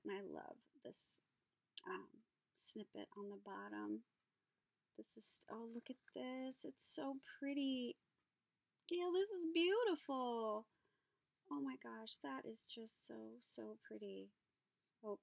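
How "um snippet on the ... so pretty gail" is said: normal talking voice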